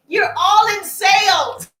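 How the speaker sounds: loud, slow, high shout. Real, not acted. Coach shouting to her sales students, loud and slow. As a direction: hammer it home to the whole room. Coach hammering the point home.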